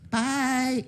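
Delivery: sing-song high goodbye; high cheery bye. Retold or imitated. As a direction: call out bye brightly, high up. Kid waving bye from car.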